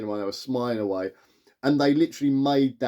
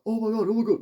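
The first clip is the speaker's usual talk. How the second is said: high-pitched mock astonishment; mock loud reaction; 10 semitones higher, faster than usual. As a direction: imitate an excitable cinemagoer. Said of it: "They were quite loud".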